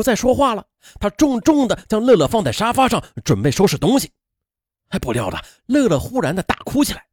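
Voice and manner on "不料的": ordinary delivery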